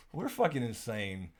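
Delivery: normal talking voice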